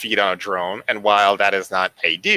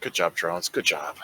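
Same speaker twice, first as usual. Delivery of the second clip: low, soft pat on the back; soft mock praise; 4 semitones lower, 8 dB quieter than usual. Player praising the drones after their successes, low and soft. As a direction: murmur praise as if to loyal minions.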